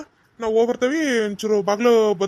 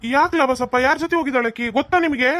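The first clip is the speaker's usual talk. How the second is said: higher-pitched, indignant questioning; stern confrontation; 4 semitones higher, 3 dB louder than usual. Acted. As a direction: demand answers as an angry elder. Elder confronts father over missing girl.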